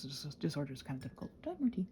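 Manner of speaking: usual speaking voice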